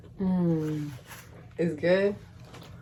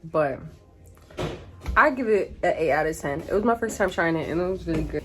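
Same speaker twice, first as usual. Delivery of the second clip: usual speaking voice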